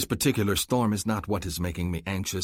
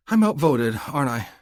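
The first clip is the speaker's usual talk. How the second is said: resigned sigh; 8 semitones higher, 7 dB louder than usual. A narrator's reading. Resigned woman.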